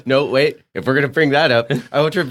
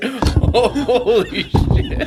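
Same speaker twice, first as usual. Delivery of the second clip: high, slow laughing exclaim; 11 semitones higher, much slower than usual. Retold or imitated. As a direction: blurt it through laughter. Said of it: "I was like".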